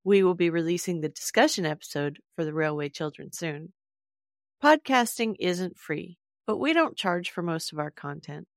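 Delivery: usual speaking voice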